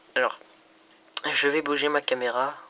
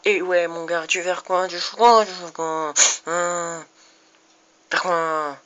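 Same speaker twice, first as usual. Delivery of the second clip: taunting; 5 semitones higher, 5 dB louder than usual. Real, not acted. Taunting another player in game.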